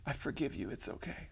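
soft, tiny imitated girl voice; hushed, put-on sweetness; sweet forgiveness. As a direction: gently let him off, imitating her. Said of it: "the sweetest thing ever".

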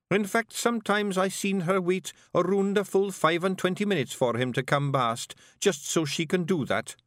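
rowdy little Scots pixie voice; broad Scottish accent. Acted. Willie speaks.

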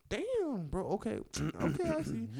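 high, breathy-free squeaky stunned voice; high-pitched flustered okay; stunned awe. Retold or imitated. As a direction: react like you just saw something unbelievable. Stunned reaction to attractive nurse.

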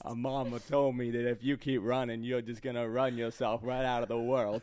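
slow Southern Gump, breaking into laughs; laughing through a slow Forrest Gump voice; Forrest Gump impression. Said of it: "going to Forrest Gump".

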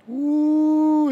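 high, loud joyful whoop; excited whoop. Real, not acted. Hyped whoop to his listeners about crying out to God. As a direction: let out a high woo of delight.

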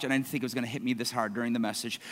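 quiet, fast, emotional confession; overcome, rushing through it softly; choked up. Real, not acted. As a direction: voice dropping with emotion. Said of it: "it moved me so much".

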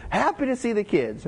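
high, bright-voiced cheery old man greeting; Carmen's cheerful greeting. Father-in-law greeting family at the funeral, cheerfully high. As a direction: greet them like a happy grandpa at the door. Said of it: "He goes".

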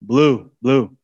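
father calling his son Blue. Retold or imitated. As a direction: call Blue like across the yard. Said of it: "Like when I called to my son".

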